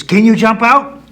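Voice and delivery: high, very loud question; loud storybook rabbit yell; shouting down. Acted. Rabbit shouts down deep hole.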